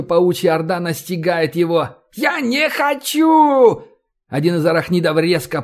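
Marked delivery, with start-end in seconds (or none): 2.1-3.8 very high, drawn-out wail; frantic screaming. A narrator's reading. Fleeing man overtaken by the swarm, frantic drawn-out scream. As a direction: scream it in mortal terror. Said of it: "истошно закричал Ковальский".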